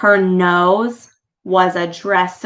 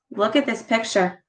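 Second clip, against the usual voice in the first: ordinary delivery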